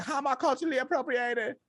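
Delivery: high-pitched, hurried mock complaint; high, rapid mimicry of an aggrieved influencer; imitating young woman online. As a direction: whine defensively in a high put-on voice. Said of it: "her whole I G was like".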